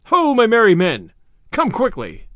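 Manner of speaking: high, loud storybook hail, then falling; calling out. Acted. Robin summons his merry men, calling out.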